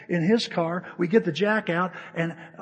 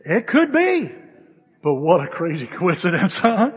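teasing incredulity; 9 dB louder than usual. Acted. Pastor to congregation about the coincidence, teasingly incredulous. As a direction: concede it in mock squeaky disbelief.

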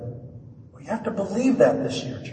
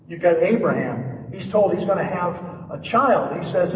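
ordinary talk

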